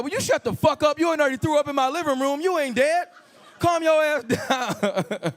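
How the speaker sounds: high-pitched, annoyed friend; exasperated scolding. Acted. Friend tells screaming guest to calm down, exasperated.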